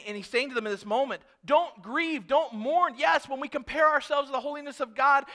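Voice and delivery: high, loud preacher's exhortation; urging a congregation at full voice; impassioned preaching. Real, not acted.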